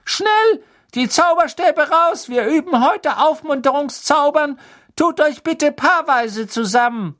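very high, loud, squeaky teacher voice; piepsiger Professor. A narrator's reading. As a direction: bustle the class along, piping. (hohe, aufgekratzte Piepsstimme)

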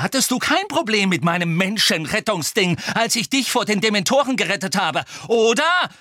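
high-pitched angry outburst; furious, frustrated roar. A narrator's reading. Harry raging at Hermine over Sirius, furious and frustrated. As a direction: rant in rage and frustration. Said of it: "Harry brüllte auf vor Wut und Enttäuschung".

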